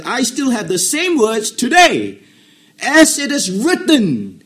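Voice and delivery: slow, loud and high, as if from the pulpit; full-force preaching. Acted. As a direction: build it up and proclaim. Preacher building to a climax.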